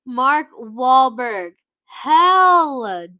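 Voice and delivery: high, drawn-out loud announcement; loud slow verdict. Real, not acted. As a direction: announce it like a judge. Verdict on a celebrity.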